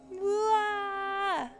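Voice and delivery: high, drawn-out mock wail; playful cry held high. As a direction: let out a long high "wah". Playful wail during game.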